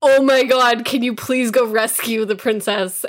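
loud, pleading mock outburst; exasperated boat. Boat begs player to rescue the princess, loud mock plea. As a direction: beg loudly, hammed-up exasperation. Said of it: "boat being like".

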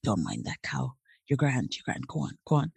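low, softened voice of a reassuring official; Dublin immigration officer. Retold or imitated. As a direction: imitate a man quietly waving someone through. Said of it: "he was like".

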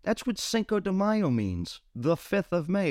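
a light mock of a friend losing patience; exasperated friend. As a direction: explain it like it's obvious. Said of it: "It's like".

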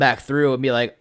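normal talking voice

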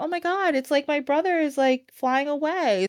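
put-on high, wondering girl voice; mock amazed Marjorie; happy awe. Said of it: "she's like".

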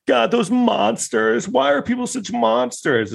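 high, slow mocking whine; high-pitched sarcastic whine of fake outrage; mock whiny lament.

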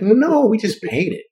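high, slow naive voice imitating Dory; teasing imitation of Dory's prim reply; mock innocent answer. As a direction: play it dumb and innocent. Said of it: "It's like".

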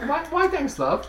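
put-on high, prim British accent; comic high British accent; mock British accent. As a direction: coo it like a proper Brit. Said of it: "hillbilly Australian British".

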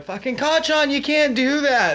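put-on a small girl telling off Kacchan; scolding little girl. Deku's daughter scolds.

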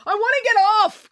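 high, panicked cry; very high frantic demand. A narrator's reading. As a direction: cry out desperately to escape. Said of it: "cried April".

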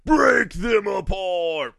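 slow, hammed-up show-intro delivery; drawn-out announcer emphasis. Host introducing the show, slow and hammed-up. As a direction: stretch it out like a big announcer.

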